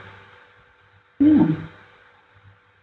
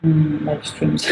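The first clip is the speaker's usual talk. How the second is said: usual speaking voice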